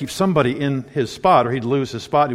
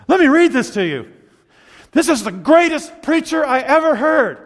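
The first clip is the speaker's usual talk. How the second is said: quoting wife; 8 semitones higher, 4 dB louder than usual. Retold or imitated. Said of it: "and she'll say".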